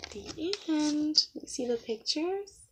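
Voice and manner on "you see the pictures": ordinary delivery, nothing marked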